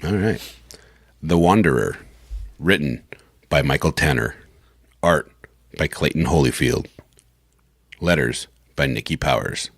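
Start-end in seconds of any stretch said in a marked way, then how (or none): 1.2-9.7 low, measured announcer voice; announcer credits read. Acted. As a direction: read each credit low, with pauses between. Reads comic credits for recording.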